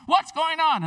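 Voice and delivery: high, loud alarmed question; freaking out. Retold or imitated. Sister alarmed at the blood, high and loud. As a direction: demand answers in a panic. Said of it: "freaking out".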